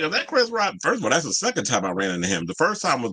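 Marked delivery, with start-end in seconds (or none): 0.0-0.7 high-pitched retold double take; surprised recognition. Streamer retelling a celebrity sighting, surprised. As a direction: pitch the question way up, acting out the surprise. Retells spotting Chris Rock.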